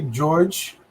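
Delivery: ordinary delivery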